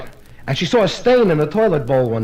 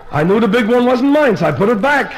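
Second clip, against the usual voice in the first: raised, emphatic punchline; worked-up explanation; 5 semitones higher, 4 dB louder than usual. Acted. Husband explaining his wet pants to his wife, worked up. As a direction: push it higher and louder, justifying yourself.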